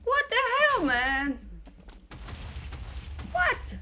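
shocked shout. Real, not acted. Gamer suddenly hit in the battle, shocked shout. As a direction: yell out in disbelief. Killed unexpectedly in game.

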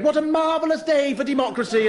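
mock live report, voice lifted; Kate Adie. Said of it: "Kate Adie stood".

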